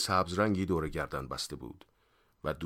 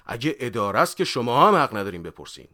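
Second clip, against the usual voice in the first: raised, heated comeback; indignant retort; 4 semitones higher, 9 dB louder than usual. A narrator's reading. Suspect argues back at officer, loudly indignant. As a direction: throw the argument back loudly. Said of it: "با تغییر گفت".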